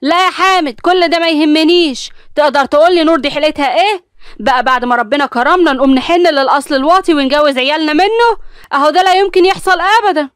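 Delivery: high, loud scornful yell; furious wife rejecting the match; angry shouting. Acted. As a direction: shout him down with contempt. Wife keeps shouting in quarrel.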